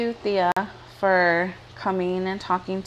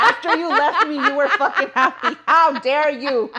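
laughing mock outrage; 6 semitones higher than usual. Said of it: "'Cause you're like".

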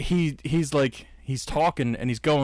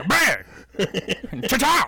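cartoon punch sounds; 7 semitones higher than usual. Cartoon fight noises.

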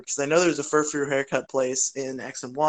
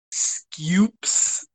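put-on French accent; 3 semitones higher than usual. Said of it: "in a really funny French accent".

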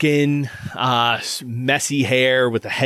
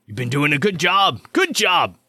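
higher, animated swooping voice; hearty armorer character; 7 semitones higher than usual. Acted. Armorer recognizing one of the little fellas, hearty and animated. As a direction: greet a newcomer with lively delight. Game master voices the surgeon.

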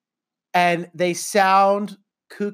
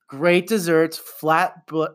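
normal talking voice

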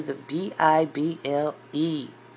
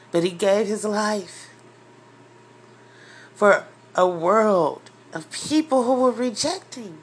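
fervent preaching; 3 semitones higher, 4 dB louder than usual. Real, not acted. Preaching climax.

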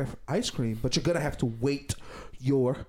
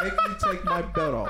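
squeaky pitched-up threat; high mock parent. Rant punchline, high.

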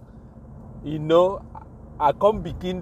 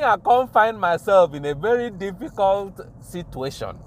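ordinary talk, nothing marked

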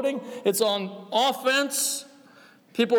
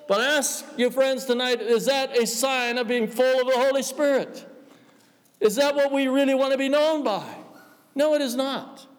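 preaching; 4 semitones higher than usual. Acted. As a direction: press the question home to the congregation. Rising preacher challenge.

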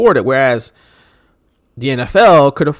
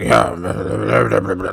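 grim reaper voice; 5 semitones lower than usual. Retold or imitated. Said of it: "he sounds like the Grim Reaper".

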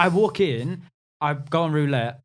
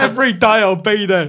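mock gambler voice; 9 semitones higher, 9 dB louder than usual. Mocking gambler's catchphrase.

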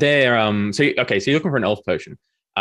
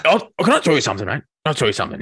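elf Douglas; 6 semitones higher, faster than usual. Acted. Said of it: "He's like".

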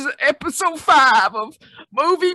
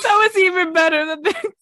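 loud laughing words; laughing hard; 6 semitones higher, 6 dB louder than usual. Real, not acted. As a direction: laugh through the line.

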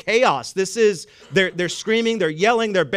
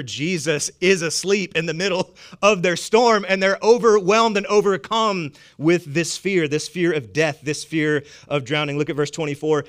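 normal talking voice

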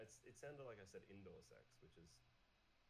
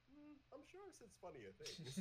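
high, slow, mock musing; doubtful inner thought; slower than usual. Voicing someone's private doubt about a compliment, high mock pondering. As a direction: think aloud in a high, doubtful little voice.

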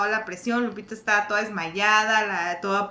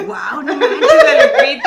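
amazed laughing exclamation; 5 semitones higher, 9 dB louder, slower than usual. Retold or imitated. Sisters amazed watching Lupita exercise.

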